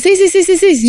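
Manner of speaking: retahíla aguda de síes, con risa; asentir por seguir la corriente. Retold or imitated. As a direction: di que sí a todo, imitando en broma. Imita a quien asiente a todo. (sí a todo, siguiendo la corriente)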